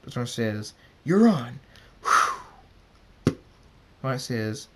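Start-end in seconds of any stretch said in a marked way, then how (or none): 1.0-1.6 loud, high swooping call; eager challenge accepted. A narrator's reading. 2.0-3.4 loud blown whoosh sound. A narrator's reading. Whoosh as the race starts.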